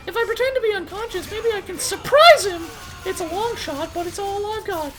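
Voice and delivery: high cartoon heroine voice; high girly heroine voice. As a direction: play the plucky captive girl. Heroine voice, improvised rhymes.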